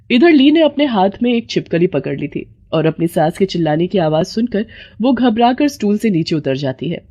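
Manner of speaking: plain reading voice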